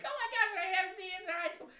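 high, fast squealed greeting; mock gushing. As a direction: imitate an overexcited old classmate. Imitating a gushing acquaintance.

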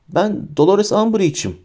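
lightly voiced frightened official; high-pitched, terrified. A narrator's reading. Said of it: "tiz, dehşet dolu bir sesle". (tiz, hafif korkulu bir sesle)

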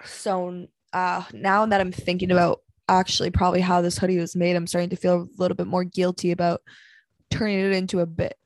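ordinary talk, nothing marked

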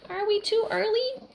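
put-on high, fluttery old queen voice; light, high royal lady; queen's voice. A narrator's reading. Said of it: "Queen Bella said".

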